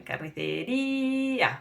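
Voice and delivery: carnicería slowly, stress on the í; slow model carnicería; careful Spanish. Shown as a demonstration. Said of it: "That's the correct spelling".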